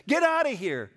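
high, loud retold order; mock commanding shout. Brother at the door to the guy, mock forceful yell. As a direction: act out yelling off an intruder.

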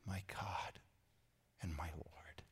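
whispered in awe; a hushed, overwhelmed confession; awed whisper. Retold or imitated. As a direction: breathe it out in wonder.

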